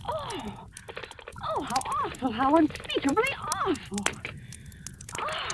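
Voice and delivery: hushed, shocked gasps of horror; horrified gasp. Acted. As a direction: recoil in breathless horror at what she heard. Overhears murder plot, horrified.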